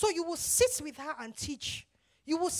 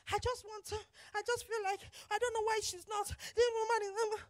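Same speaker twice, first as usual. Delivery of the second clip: higher-pitched, softer mock fretting; emotional complaint; 5 semitones higher, 4 dB quieter than usual. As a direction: act out an emotional partner. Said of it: "the one that is emotional".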